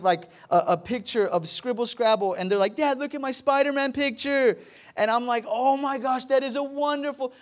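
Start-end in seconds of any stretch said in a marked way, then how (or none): 2.8-4.6 higher-pitched little kid imitation; excited child. Son showing dad his scribbled picture, imitated excited child. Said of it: "My kids, they will come to me". 5.5-7.3 high-pitched mock amazement; gushing praise. Dad praising his kid's scribble, gushing mock amazement. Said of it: "What they want is the blessing".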